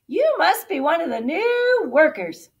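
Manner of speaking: high, loud, hearty voice; friendly farmhand. A narrator's reading. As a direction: call out a welcome to the new worker. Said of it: "a friendly voice called out".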